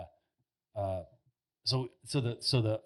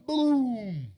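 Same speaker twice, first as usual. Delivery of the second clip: loud, high drawn-out boom; big vocal gunshot effect; imitated gunshot; 11 semitones higher, 6 dB louder than usual. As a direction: boom it out like a rifle shot. Imitates the gunshot after countdown.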